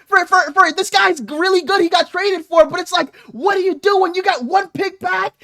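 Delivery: high-pitched, worked-up rant; exasperated explaining. Retold or imitated. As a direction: plead the case in heated disbelief. Retold exasperated explanation to partner.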